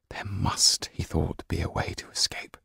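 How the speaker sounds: hushed inner voice; inner thought. Acted. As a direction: think it to yourself in hiding. Yan's thought.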